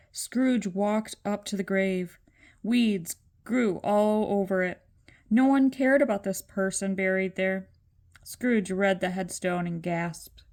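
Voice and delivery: ordinary delivery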